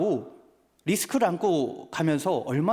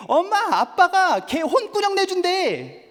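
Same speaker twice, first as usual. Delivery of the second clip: much higher, slow sing-song tattling to mom; gleeful child; 13 semitones higher, 5 dB louder, slower than usual. Retold or imitated. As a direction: announce it proudly like a little girl. Daughter tells mom gleefully.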